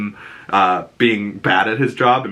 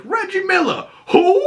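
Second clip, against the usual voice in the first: mock shout. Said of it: "And he goes".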